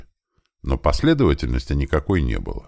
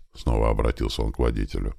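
plain reading voice

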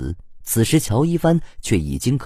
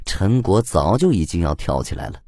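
ordinary delivery

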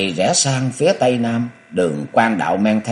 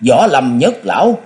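louder, higher shout of alarm; alarmed cry; 5 semitones higher, 6 dB louder than usual. A narrator's reading. Someone in the crowd spots the old master arriving, alarmed cry. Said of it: "có tiếng người la hoảng".

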